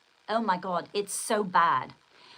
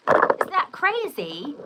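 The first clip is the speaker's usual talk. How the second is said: drawn-out, louder, higher; incredulous exclamation; emphatic; 7 semitones higher, 7 dB louder, much slower than usual. Shown as a demonstration. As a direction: stretch it out for the camera. Repeats question, emphatic second take.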